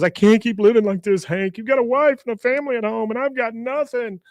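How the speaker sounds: high, hammed-up tearful outburst; loud mock wail of despair; mock crying. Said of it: "Bill starts crying and goes".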